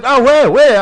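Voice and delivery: high, loud burst of mock French recognition; excited Frenchman. Frenchman suddenly recognising the name, excited high cry. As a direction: exclaim it as a delighted mock Frenchman.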